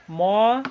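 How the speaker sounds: ম held long on its own; drawn-out syllable. Shown as a demonstration. Teacher sounding out mono for class, ম drawn out. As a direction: stretch ম as a separate syllable. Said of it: "মনো syllable হচ্ছে একবারে উচ্চারণ".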